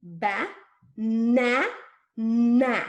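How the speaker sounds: BA... NA... NA, each NA loud with a long held N; over-articulate BA, NA, NA with gaps between; exaggerated sounds. Shown as a demonstration. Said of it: "I'm exaggerating the sounds in my mouth".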